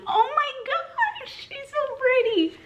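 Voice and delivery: very high, drawn-out squeak of delight; tiny, stretched-out high coo; adoring squeal. Real, not acted. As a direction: squeal slowly as if overcome by cuteness. Gushing over a beautiful new plant.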